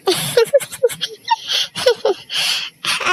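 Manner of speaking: a high laugh at a beaten friend; mocking laugh. Friend laughs at the beaten JK, high mocking laughter. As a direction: laugh at him, half crying with glee. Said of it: "तू मेरे पर हंस रहा है?".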